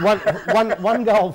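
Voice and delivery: laughing through a false start. Real, not acted. Pundit starting on a team's poor score, laughing.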